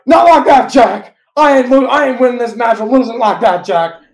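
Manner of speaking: loud, high mock shout; hammed-up wrestler yelling at ringside; John Cena. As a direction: holler it in outraged protest. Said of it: "And then Cena's like".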